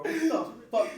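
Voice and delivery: high, loud laughing frat bro voice. Retold or imitated. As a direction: ham up a frat boy. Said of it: "sound like a damn frat boy".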